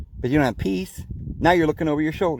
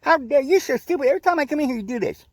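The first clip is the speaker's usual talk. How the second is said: imitating angry customer; 10 semitones higher than usual. Preacher mimics customer yelling at McDonald's girl, hammed-up rage. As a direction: ham up a rude customer's tantrum, much higher. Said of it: "You're like".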